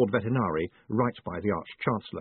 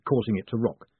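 ordinary delivery, nothing marked

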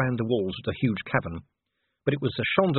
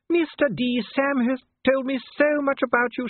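very high, gushing society lady; trilling Sybil; 3 dB louder than usual. A narrator's reading. Duchess charming the dwarf on cue, trilling. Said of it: "she trilled".

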